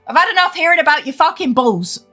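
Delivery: ordinary talk, nothing marked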